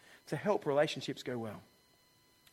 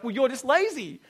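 mock insult; 7 semitones higher, 5 dB louder than usual. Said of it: "you go like".

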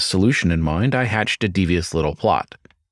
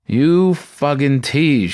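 slow, louder mock scold; 5 semitones higher, 4 dB louder, much slower than usual. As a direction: scold him slowly and pointedly. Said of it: "she scolded".

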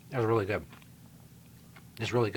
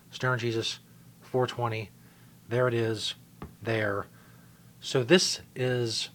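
ordinary talk, nothing marked

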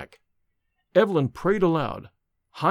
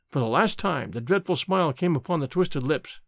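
plain reading voice

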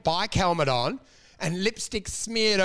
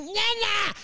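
high mock yell of Nana; granny character yelling; 13 semitones higher than usual. Comic in granny drag yelling at the open mic. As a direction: shriek for Nana like a weird granny. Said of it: "would yell weird things like".

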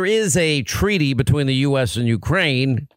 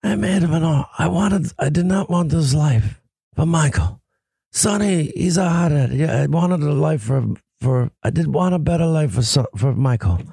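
strained, halting Godfather impression; Marlon Brando. Host as the Godfather lamenting his sons' life, strained movie impression. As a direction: play an ageing mob boss, voice altered and laboured. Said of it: "you like my Marlon Brando".

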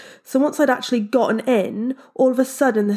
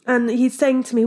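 ordinary talk

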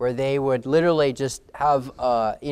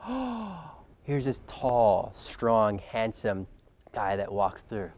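awed hush; 3 semitones lower, 6 dB quieter, much slower than usual. Retold or imitated. Crowd awed by tall Saul.

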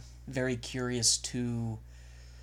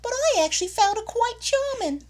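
high squeaky voice; 6 dB louder than usual. Retold or imitated. Imitating Adelaide's high voice.

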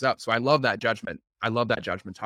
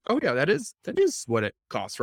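matter-of-fact; 5 semitones higher than usual. Retold or imitated. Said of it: "not from a place of".

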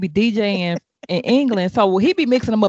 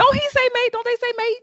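high teasing voice. Real, not acted.

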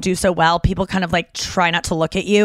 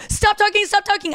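high, sharp retold command to stop talking; hammed-up high yell from inside the stall; snapping at a stranger through the stall door, high mock yell; mock shout; 12 semitones higher than usual. Confronting girl from bathroom stall.

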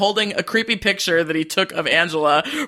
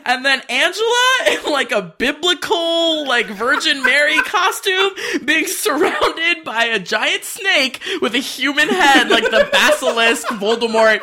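incredulous exclaiming; 8 semitones higher than usual. Real, not acted. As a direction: build the absurd list with mounting amazement. Incredulous listing of absurd montage images.

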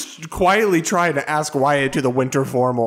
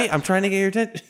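fast and urgent; 5 semitones higher, much faster than usual. Acted. Trying to get attention.

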